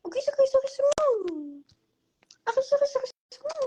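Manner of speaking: very high squeaky sing-song; baby talk. Acted. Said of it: "ganun pala yung baby talk". (napakataas na baby talk)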